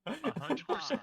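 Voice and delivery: high, loud joking blurt; excited exclaim. Pretend. Joking answer to maths.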